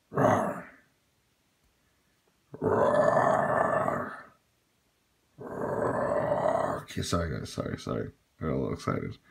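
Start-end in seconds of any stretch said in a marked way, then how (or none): 0.1-6.9 breathy mock roar; a playful mock roar. Real, not acted. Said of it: "I'm sorry about the roaring".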